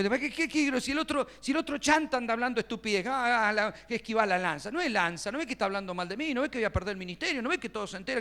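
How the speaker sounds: protesta airada, aguda y atropellada; discutí indignado, con la voz subida; enojado. Retold or imitated. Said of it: "Está enojado". (bronca acelerada, imitándose a sí mismo)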